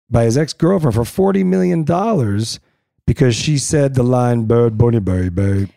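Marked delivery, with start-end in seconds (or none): 4.4-5.7 lightly imitating her sultry voice; man doing a woman's voice. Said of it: "With her voice".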